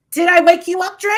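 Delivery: loud, high-pitched teasing question; high mock question. She mockingly asks Dre about waking, high loud voice. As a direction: push it high and loud, rising. Same question repeated much higher.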